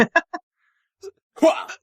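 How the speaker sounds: high shouted army whoop; gung-ho Hooah. Acted. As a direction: yell it like a pumped soldier. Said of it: "with Johnny Bravo noises".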